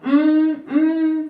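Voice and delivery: two long, slow hummed beats; slow humming. Shown as a demonstration. Teacher repeating the hum slowly for students. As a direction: stretch each hum out slowly. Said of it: "दो बार हमने हम किया".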